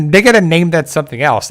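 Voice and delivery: usual speaking voice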